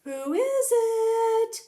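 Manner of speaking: put-on high, slow old lady calling out; old Granny calling. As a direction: call out as a frail granny. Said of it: "called out Granny".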